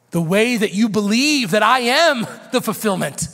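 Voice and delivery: fiery revival preaching; preaching at full force. Acted. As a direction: build to a shouting crescendo.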